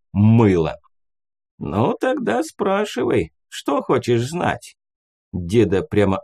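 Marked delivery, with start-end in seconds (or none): 1.6-4.8 put-on high, slow puffed-up old man; self-important old ghost. A narrator's reading. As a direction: lord it over the listener.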